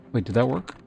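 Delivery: usual speaking voice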